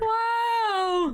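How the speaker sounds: real excited cry, high; thrilled exclamation; amazed wow. Real, not acted. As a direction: gasp it out in awe. Amazed at the view counts.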